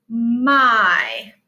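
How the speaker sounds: My said with a long I sound, clearly and firmly; teacher repeating the sight word My clearly for kids; clear model word. Shown as a demonstration. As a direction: say My with a long drawn I. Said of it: "The Y is making a long I sound".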